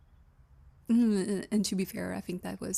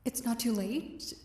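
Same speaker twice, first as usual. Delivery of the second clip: normal talking voice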